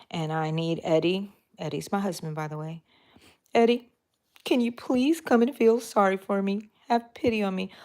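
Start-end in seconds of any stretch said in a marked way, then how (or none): 3.5-7.7 whiny plea. Retold or imitated. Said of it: "have a little pity party".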